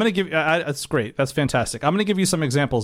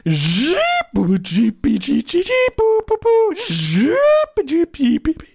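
squeaky high beeps and boops, louder; imitated phone noises; 13 semitones higher, 5 dB louder, slower than usual. Caller imitating crazy phone noises to the clerk, high squeaky beeps. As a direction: make the static sounds with your mouth, going very high. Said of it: "his onomatopoeia call".

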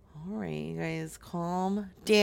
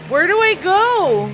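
panicked shout, higher and louder; frantic yelling; 8 semitones higher, 7 dB louder than usual. Acted. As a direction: shout it like you're lost and desperate. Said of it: "so it sounds like you're frantic".

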